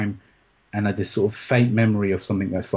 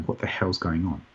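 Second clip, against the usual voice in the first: softer, lost mumble like a zombie; dazed zombie; 5 semitones lower, 6 dB quieter than usual. Retold or imitated. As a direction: mutter it half-dazed, barely there. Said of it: "wandering around like a zombie going".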